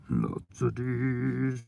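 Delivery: slow suggestive drawl. Real, not acted. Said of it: "innuendo joke repeated slowly".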